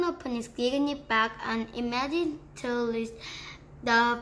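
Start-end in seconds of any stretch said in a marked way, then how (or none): none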